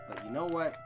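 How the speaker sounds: ordinary delivery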